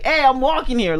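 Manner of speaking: put-on New York street accent. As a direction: toss it out as a stock New Yorker line. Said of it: "New York kind of more stereotypical accent".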